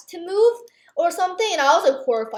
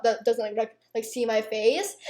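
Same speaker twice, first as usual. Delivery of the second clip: usual speaking voice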